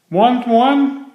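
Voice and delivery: a friendly voiced question; Norman offering. A narrator's reading. Norman offering Jim a banana, high and friendly. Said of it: "Norman asked".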